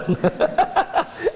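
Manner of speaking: a short amused laugh up high; light chuckle; high breathy laugh. Real, not acted. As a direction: laugh at the silly example. Laughs at own example.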